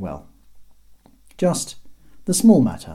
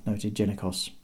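plain reading voice

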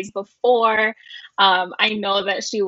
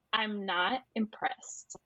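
unimpressed; 2 semitones lower, 13 dB quieter, slower than usual. Retold or imitated. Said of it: "she's just so upset".

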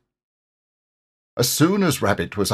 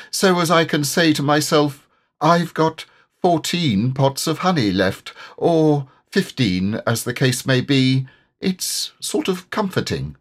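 higher, gentle bear voice for Pooh; Pooh's voice; 6 semitones higher than usual. A narrator's reading. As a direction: play the humble, simple bear. Pooh's voice in the story.

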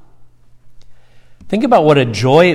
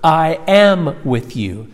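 "am" stretched out and raised; stress on AM; 4 semitones higher, slower than usual. Shown as a demonstration. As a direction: slowly, lean hard on "am". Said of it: "continuous present tense verb".

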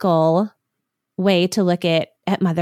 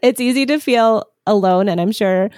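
normal talking voice

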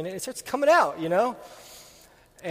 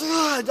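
a sharp, high grunt of rage, louder; angry grunt; 11 semitones higher, 5 dB louder than usual. Retold or imitated. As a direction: let out a furious ugh as the brush flies. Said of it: "I went in a fit of rage".

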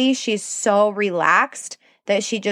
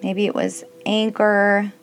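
guided meditation. Acted. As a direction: gently guide them into stillness. Leads a kids' meditation.

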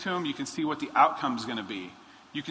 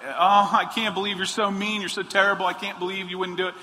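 put-on higher, louder whiny kid complaint; upset kid; 6 semitones higher, 6 dB louder than usual. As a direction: mimic a sulking child who was told no. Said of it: "your kid's all upset, and you're like".

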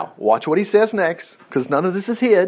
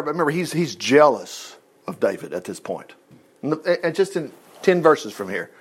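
usual speaking voice

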